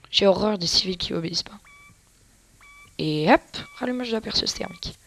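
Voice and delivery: ordinary talk, nothing marked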